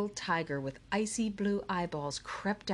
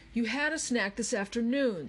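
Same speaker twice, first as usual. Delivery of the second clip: higher-pitched irritated retort; snapping; 6 semitones higher, 3 dB louder than usual. A narrator's reading. Charles to hungry Andrew during the book search, snapping. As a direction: snap at a whining companion. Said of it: "snapped Charles".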